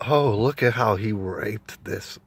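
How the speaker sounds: slow, hushed mock true-crime narrator; mock creepy narrator. Vlogger mocking a true-crime TV narrator, hushed and slow. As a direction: ham up a creepy crime-show host, slowly.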